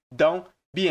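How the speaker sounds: "don't be" sounded as "don be"; dropped T. Shown as a demonstration. Teacher repeating the spoken form for learners, T dropped from "don't". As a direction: leave the T off "don't" before "be". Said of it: "geralmente comem esse T aqui".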